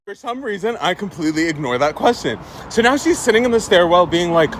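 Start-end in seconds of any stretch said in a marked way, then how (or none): none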